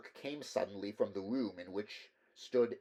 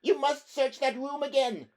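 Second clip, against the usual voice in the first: much higher, louder, cracking hysteria; hysterical shrill; 9 dB louder than usual. Acted. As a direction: shriek in panic, voice breaking. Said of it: "voice shrilled and cracked".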